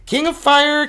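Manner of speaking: King of Fire called out high and bright; excited announce. Real, not acted. Excited card reveal.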